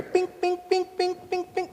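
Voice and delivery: high, thin chiming bing noises; ping it out like a blinker; mock blinker chime; turn signal bings. Mimics the car's turn signal.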